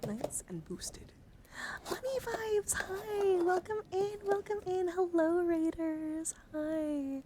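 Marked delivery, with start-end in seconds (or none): 1.9-7.2 high, loud, singsong welcome; excited greeting. Real, not acted. Streamer welcoming arriving raiders, excited high greeting. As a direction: burst into a delighted, high-pitched hello.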